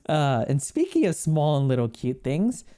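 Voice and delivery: usual speaking voice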